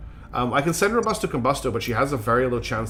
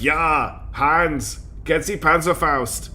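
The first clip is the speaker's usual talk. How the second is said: bad German accent, a little higher; attempted German accent; 4 semitones higher, much slower than usual. Shown as a demonstration. Streamer showing viewers their bad accents, attempted German accent. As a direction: have a go at a rough German accent. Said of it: "hear me attempt a German accent".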